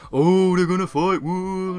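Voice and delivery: high, drawn-out jeering whoop; mock crowd hype. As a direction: egg on a fight like a whole crowd. Imitates crowd gassing up a fight.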